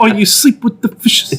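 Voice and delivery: Italian American mobster threat; friendly threat. Pretend. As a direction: mob boss warning. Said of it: "That's how you should say your vows".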